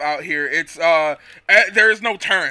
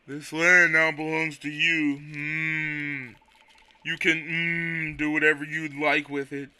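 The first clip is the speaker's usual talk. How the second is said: slow, soft, sleepy drawl; the slow Quagsire voice kept up; 3 semitones lower, 5 dB quieter, slower than usual. Acted. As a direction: let the words crawl out with a hum. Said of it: "my Quagsire voice".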